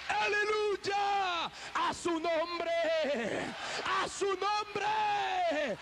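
rising, shouted preacher's cries of praise; full-force preaching. Acted. Preacher rousing the congregation, shouting praise. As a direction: preach it out to the whole church.

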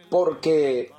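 normal talking voice

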